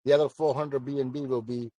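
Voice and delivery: usual speaking voice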